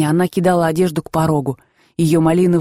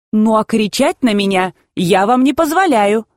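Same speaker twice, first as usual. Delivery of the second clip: usual speaking voice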